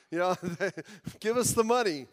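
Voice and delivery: chuckling through the punchline; preacher laughing at his own offering joke. Real, not acted. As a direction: laugh as you ask for the money. Joke about church offerings.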